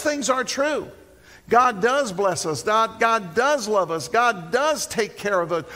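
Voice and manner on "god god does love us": normal talking voice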